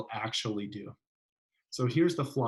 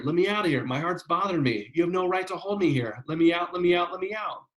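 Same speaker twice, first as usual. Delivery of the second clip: higher and louder, building panic as the pleas repeat; desperate pleading; 6 semitones higher, 3 dB louder than usual. Retold or imitated. Learner begging through the wall to be released, rising panicked pleas. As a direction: beg to be let out, voice climbing. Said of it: "would start saying".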